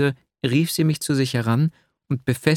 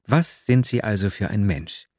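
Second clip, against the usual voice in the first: ordinary delivery, nothing marked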